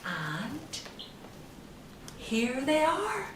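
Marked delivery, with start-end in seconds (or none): none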